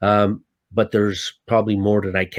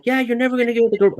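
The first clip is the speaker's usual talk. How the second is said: high, fast scoffing voice; mock him as a scoffing doubter; 13 semitones higher, faster than usual. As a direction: crew member scoffing that he'll fail. Voicing doubting crew member.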